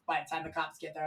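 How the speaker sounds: normal talking voice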